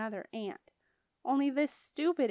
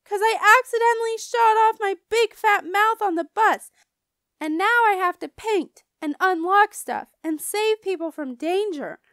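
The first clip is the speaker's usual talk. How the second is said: high, loud wailing confession; frantic yell; 11 semitones higher, 11 dB louder than usual. A narrator's reading. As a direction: pour it out to mom at full volume. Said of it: "I yelled to Mother".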